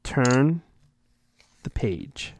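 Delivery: ordinary talk, nothing marked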